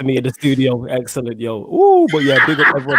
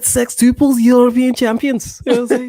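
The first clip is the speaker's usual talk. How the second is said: put-on Scouser accent; 10 semitones higher than usual. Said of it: "try to do that accent".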